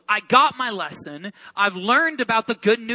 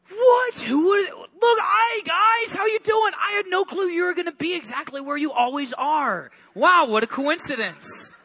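high, hammed-up fake surprise; mock surprise; 7 semitones higher than usual. Jesus greeting the fishermen with hammed-up surprise. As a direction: pretend you didn't plan this meeting.

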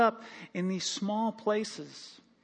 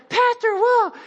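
much higher and louder, thrilled; excited shout; 8 dB louder, slower than usual. Retold or imitated. Fan recognizing pastor on trail, excited high shout. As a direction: yelp his name, starstruck. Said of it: "they're like".

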